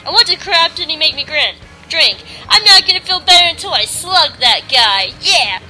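angry ranting. Acted. Alice fuming after the kiss.

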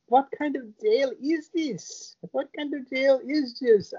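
slow, high, whiny woman's voice; mock indignant woman, pitched high; high mock complaining woman. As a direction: whine it up high like her. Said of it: "she keeps going".

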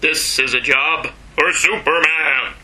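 slow heroic radio announcer voice; a light take on the old radio Superman; radio Superman baritone. Retold or imitated. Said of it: "He's very mod-, baritone".

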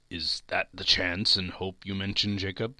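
usual speaking voice

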